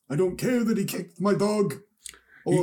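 high put-on caricature voice; Fox News. Friend joining the Fox News bit, high caricature. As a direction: play it high and silly. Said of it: "They're gonna be like".

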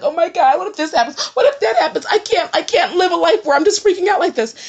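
high, loud mock panic; acting out a frantic spiral of what-ifs; re-enacted freak-out. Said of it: "I would freak out and be like".

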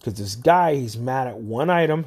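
ordinary talk, nothing marked

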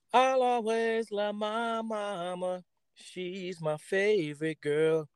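slow, high and tender; slow sweet crooning about mama. Real, not acted. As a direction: stretch out each word lovingly for his mother. Fond tribute to his mama.

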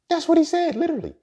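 shouted insistence. Real, not acted. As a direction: yell it like you can't believe it. Insisting on the story's point.

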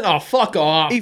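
normal talking voice

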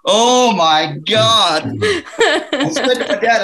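barely getting it out for laughing. Real, not acted. Telling friends the story, laughing hard.

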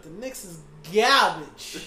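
laughing through it; slow, loud and laughing. Real, not acted. Laughing dismissal of the Knicks.

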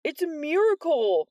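high, loud mock amazement; hammed-up miracle shout; mock astonishment. A narrator's reading. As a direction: ham up the surprise. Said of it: "in mock astonishment".

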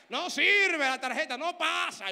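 loud, high mock announcement; cashier yelling. Cashier yelling the card won't pass, loud and high. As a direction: shout it across the store. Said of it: "ella vocea".

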